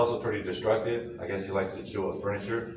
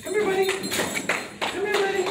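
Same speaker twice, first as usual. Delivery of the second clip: very high, louder sing-song call; coaxing dog call; 4 dB louder than usual. Real, not acted. Trainer calling the puppy over to him, in a high coaxing call. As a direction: coax the dog to come to you.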